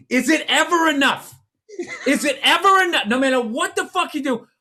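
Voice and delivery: loud, high exasperated outburst, imitating a friend; mock a ranting voicemail at high pitch; hammed-up exasperated complaint; launching into a rant. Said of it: "You launch into it".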